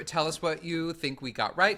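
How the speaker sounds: ordinary delivery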